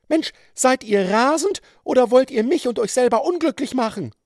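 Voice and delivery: high, loud woman's outburst; dismayed cry. A narrator's reading. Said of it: "rief die bestürzte Frau". (bestürzte, laute hohe Frauenstimme)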